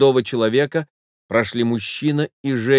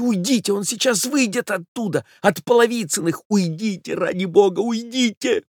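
high, breathless society lady voice; a frightened wife begging him off; flustered lady; 10 semitones higher than usual. Acted. Wife pleading with her lover to leave, high flustered voice. Lady's voice in the story.

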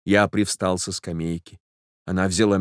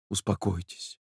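much softer, gentle calming; calm her gently; soothing hush; 11 dB quieter than usual. A narrator's reading. Young man soothing the weeping girl, soft and gentle.

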